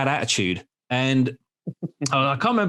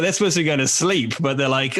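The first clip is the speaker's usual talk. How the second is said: ordinary talk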